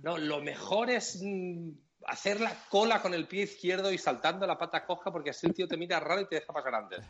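lightly voiced smug guru; mock know-it-all. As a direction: send up the smug park expert. Mocking a park guru's advice.

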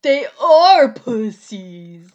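loud, stretched-out imitation with a huge ARE; mock dude yell. Co-host echoing the dude's line, hammed-up drawn-out yell. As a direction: ham up the insistent dude, dragging the words.

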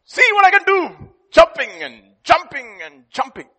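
loud, high boastful cricket; showing-off cricket. Acted. Cricket showing off to the inchworm, loud and boastful. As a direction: brag it out, full of yourself. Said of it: "So he's showing off".

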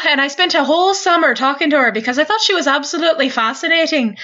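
lilting, storytelling Irish accent. Shown as a demonstration. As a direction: chat away with an Irish lilt. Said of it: "I can slip into that one".

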